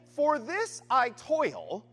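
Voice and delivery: "this" lifted in "For this I toil", said slowly; stress on THIS. Shown as a demonstration. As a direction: lean on the word "this" in "For this I toil". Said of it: "it's on this".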